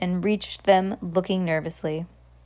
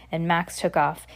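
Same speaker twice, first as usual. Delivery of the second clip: plain reading voice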